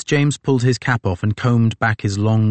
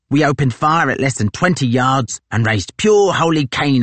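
loud, higher boasting soldier; brash boast; 6 semitones higher, 4 dB louder than usual. Acted. Tozer to Crozier, loud brash boasting. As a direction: brag about the ambush.

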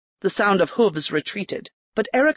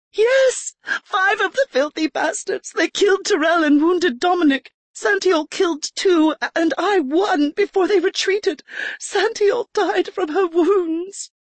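put-on much higher, shaken young lady's voice; Erica, upset noblewoman; 12 semitones higher than usual. A narrator's reading. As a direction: spill the story in distress. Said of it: "Erica recounts the attack".

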